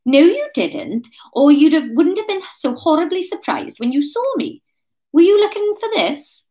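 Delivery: high, loud character retort; confronting. Acted. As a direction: counter him, then produce the proof. Kate counters Ryan in character.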